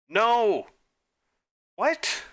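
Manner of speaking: high, loud cry of no then a squeaky what; shocked outburst. Real, not acted. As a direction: caught completely off guard. Wrong answer shock.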